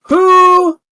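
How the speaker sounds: loud, high call for attention. Real, not acted. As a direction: shout to grab the table. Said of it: "GM calls out to players".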